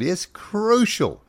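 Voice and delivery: high, drawn-out mocking emphasis. Real, not acted. Presenter mocking the article's word with high, slow emphasis. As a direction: stretch it out high, as if over the top.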